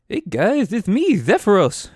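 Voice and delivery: big cheerful giant greeting the party; Zephyros giant voice. Acted. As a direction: boom it out as a friendly cloud giant. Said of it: "Why did I do the voice?".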